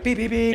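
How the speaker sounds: high-pitched repeated horn beeps; quick, high beep-beep honking; imitated car horn. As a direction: mimic an insistent car horn. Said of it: "stop beeping the horn".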